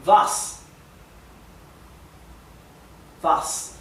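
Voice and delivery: Was said vahs, V at the start; Was, W spoken as V; German was. Shown as a demonstration. Teacher models Was for students, V sound. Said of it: "La W se pronuncia V".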